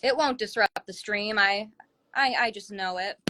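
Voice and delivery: ordinary talk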